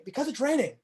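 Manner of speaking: a touch of mock anger over the weather; annoyed. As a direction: play it as grumpy about the rain. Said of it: "and I'm really angry".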